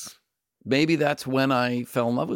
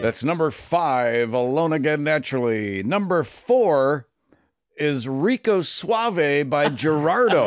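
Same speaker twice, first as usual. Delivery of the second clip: Shadow Stevens; 4 semitones higher than usual. Acted. Guest moves to number four on his list, announcer impression. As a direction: draw out numbers and titles, radio-host style. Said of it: "my Shadow Stevens voice".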